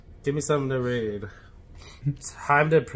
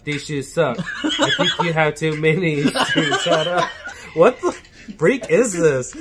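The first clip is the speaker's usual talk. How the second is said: speech jammer struggle; 5 dB louder than usual. Real, not acted. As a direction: drag the words out, fighting feedback. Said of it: "Speech Jammer app".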